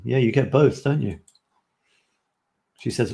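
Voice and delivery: ordinary talk, nothing marked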